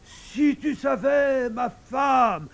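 high, drawn-out frantic cry; hoarse, wild-eyed exclamation. A narrator's reading. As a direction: burst home with astounding news. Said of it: "la gorge rauque, les yeux exorbités". (cri éperdu, la gorge rauque)

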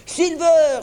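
loud, high sustained cheer; rousing acclaiming shout; crowd cry. A narrator's reading. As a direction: cry it out like a whole crew. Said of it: "s'écrièrent-ils".

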